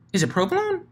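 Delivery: high, squeaky guess; Pinky blurting a cheese guess. Acted. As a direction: toss the guess up high, unsure.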